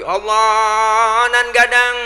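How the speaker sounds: loud Padang takbir. Retold or imitated. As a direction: belt it out like a Padang villager. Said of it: "Orang Padang kencengan lagi". (takbir logat Padang, keras dan panjang)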